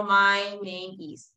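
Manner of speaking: "My name is" said slowly and clearly; slow example. Shown as a demonstration. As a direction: spell out "My name is" word by word, unhurried. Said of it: "por ejemplo".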